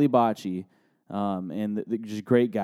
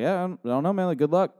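higher-pitched, noncommittal brush-off; verbal shrug; 8 semitones higher, faster than usual. Retold or imitated. Dodging a player's question with opponents nearby, noncommittal shrug. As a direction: play it like a shrug, feigning ignorance. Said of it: "shrugging my shoulders".

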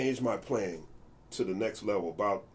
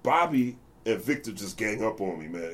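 usual speaking voice

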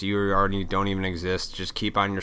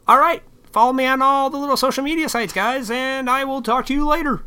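much higher, fast, bright puppet frog voice; Kermit the Frog; 6 dB louder than usual. Acted. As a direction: sign off as a chirpy puppet frog. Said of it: "I sounded like Kermit the Frog".

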